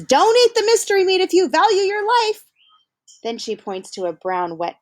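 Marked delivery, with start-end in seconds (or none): 0.0-2.4 put-on high, bright girl voice warning; girl's warning. Hannah warning Keck about the mystery meat, in a high girl voice. Girl warns new student.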